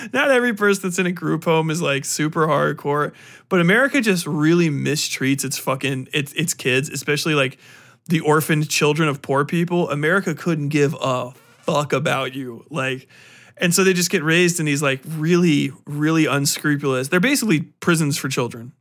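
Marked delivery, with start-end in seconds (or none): none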